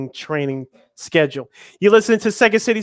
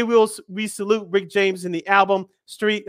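usual speaking voice

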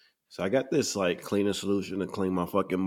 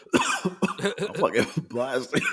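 coughing laugh; 8 semitones higher, much slower than usual. Real, not acted. Coughing after a hit, laughing.

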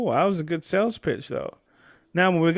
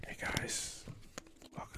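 soft unvoiced whisper into the mic; ASMR whisper; 20 dB quieter, slower than usual. Host greeting viewers in a mock ASMR whisper. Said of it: "a little A S M R".

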